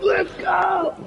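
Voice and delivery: high-pitched fan cheer; high fan cheer over a week-one touchdown; excited cheer. Retold or imitated. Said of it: "I was freaking out".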